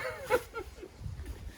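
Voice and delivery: high, wheezy-quiet laugh; helpless laugh at your own joke; cracking up; laughing fit. Real, not acted.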